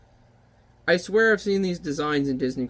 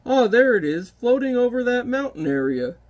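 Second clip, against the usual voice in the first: pitched-up oh, there it is; spotting it; 7 semitones higher, 3 dB louder than usual. Acted. Giant spotting the cloud over the mountain, surprised. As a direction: sound like you just spotted it. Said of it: "giant spots the smog".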